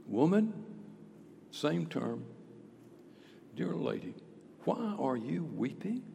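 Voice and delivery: soft, tender question; gentle Jesus. Acted. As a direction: ask her quietly, with care. Jesus gently asks Mary.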